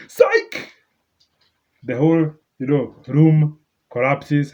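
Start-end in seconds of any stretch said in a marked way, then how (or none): 0.1-0.7 sudden high-pitched "Psych"; high squeal. Retold or imitated. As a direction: squeal it out with glee. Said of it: "Then he's going to be like".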